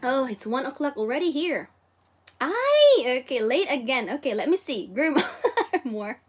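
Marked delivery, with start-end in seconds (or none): 2.4-3.2 surprised ay. Real, not acted. Realizes it is already late.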